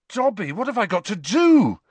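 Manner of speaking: loud urgent plea; desperate plea. Acted. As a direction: beg for help in panic. Harry desperate.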